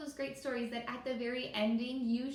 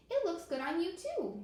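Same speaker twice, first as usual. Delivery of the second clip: a lightly higher, friendly turtle voice; turtle answering; 7 semitones higher, 4 dB louder than usual. Acted. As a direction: return the compliment gently.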